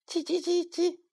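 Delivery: high chirping like a sparrow; high childlike sparrow chirp; boy copying chirp. A narrator's reading. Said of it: "小明学着叫起来".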